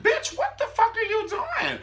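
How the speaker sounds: very high, loud comic disbelief; mock outraged squeal. Son to fiance on the bed, mock outraged squeal. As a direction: squeal it like a scandalized cartoon.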